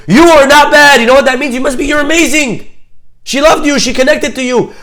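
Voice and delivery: high, loud, gushing excitement; acted elation. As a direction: play an overjoyed mother raving down the phone. Thrilled mother retold, raving to speaker.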